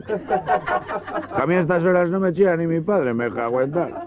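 put-on grumbling drunk. Hungover drunk grumbles at the doctor.

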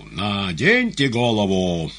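slow, drawn-out loud order; loud command. A narrator's reading. Magician ordering the head put back, loud drawn-out command. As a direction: command it loudly across the stage. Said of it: "И громко приказал".

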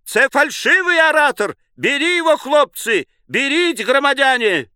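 high, urgent accusing yell; excited very high cries; thin tenor shouting. A narrator's reading. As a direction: call the crowd to seize him. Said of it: "второй тонкий тенор".

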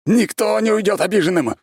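high, loud manic shout; raging threat. A narrator's reading. Mad ex-emperor threatens every prisoner, raving loudly. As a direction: yell it as a menacing promise.

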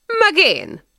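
high, eager cry; tipsy holler, not full volume; whooped. A narrator's reading. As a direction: whoop it out, pitched well up. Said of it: "rikkantott a Csáki".

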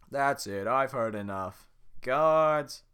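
loud slow command, rising to a shout; guard losing patience, yelling for help; guard calls out. Acted. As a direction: summon the guards with a bellow.

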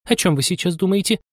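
high-pitched mouse reproach; stern mouse. A narrator's reading. Mouse demanding what Anya is thinking, sternly. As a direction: question crossly in a high mouse voice. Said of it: "грозно сказала мышь".

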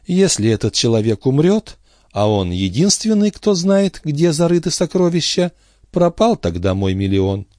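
high, anxious figure, dropping at the end; worried character voice. Acted. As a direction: fret in a high voice, then sink. Ben Omar's worried thought, acted. (высокий встревоженный голос)

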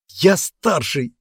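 high, slow, indignant protest; almost shouting. A narrator's reading. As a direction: protest hotly, just short of shouting. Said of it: "чуть не закричал мальчик".

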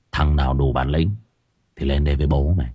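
low, soft menacing gangster growl. Acted. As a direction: speak like a street mob boss. Gangster voice again. (giọng xã hội đen trầm, đe dọa)

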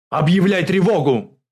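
very loud, high military command; shouted order. A narrator's reading. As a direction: yell out an emergency order. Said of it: "крикнул Журбин". (выкрикнуть приказ)

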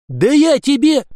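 loud, high sputtering yell; furious shout. A narrator's reading. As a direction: blow up in rage. Furious commissar threatens the lad.

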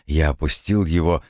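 ordinary delivery, nothing marked

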